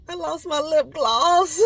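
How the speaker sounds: high, loud, drawn-out whine; mock sad wail. Breaking sad news to viewers, mock sad wail. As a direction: wail it slowly like a playful cry. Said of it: "I'm sad, guys".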